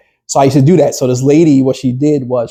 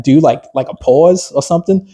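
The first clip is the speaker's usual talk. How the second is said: ordinary talk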